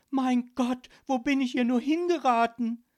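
high, loud cry of alarm; deeply frightened. A narrator's reading. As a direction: wail it in fright. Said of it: "Sie war zutiefst erschrocken".

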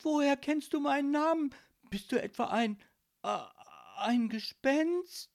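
put-on alarmed girl, voice pushed up; frightened stammer. A narrator's reading. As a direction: falter before daring the last word. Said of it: "stammelte sie mit zittriger Stimme".

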